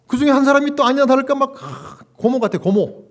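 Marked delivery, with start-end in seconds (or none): none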